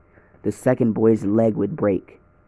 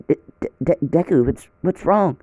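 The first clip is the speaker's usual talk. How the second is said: higher, stammering with alarm; worried stammer; 7 semitones higher than usual. Acted. Bakugo and Rei seeing Deku in tears, anxious stammer. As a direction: stumble over his name in sudden concern. Friends see Deku crying.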